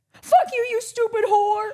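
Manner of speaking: high, loud, slow curse; furious yell. Retold or imitated. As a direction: spit it at her. Girlfriend cursing her, retold.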